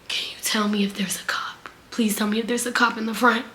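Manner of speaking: low, secretive begging, acted out; furtive whisper. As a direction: whisper it like someone hiding from police. Acts out his furtive plea.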